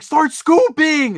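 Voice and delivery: high, loud, stretched-out mock shout; imitated yelled order. Storyteller imitates woman commanding him at the bins, yelling. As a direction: holler it like a frantic bossy shopper.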